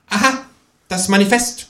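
high, know-it-all kangaroo remark; smart-alecky. A narrator's reading. Kangaroo smugly identifies the Manifesto. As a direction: state it like a smug pupil. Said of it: "konstatiert das Känguru altklug".

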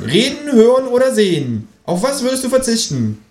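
high kangaroo voice; Känguru voice. Acted.

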